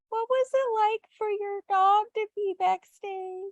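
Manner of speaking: put-on small, high, nervous kid voice, a touch scared; mimicking a terrified kid. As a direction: play the frightened little interviewer. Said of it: "And he sounds terrified".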